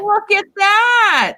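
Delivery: high, slow and delighted; excited. Real, not acted. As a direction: gush over the piece. Admiring the stone holder.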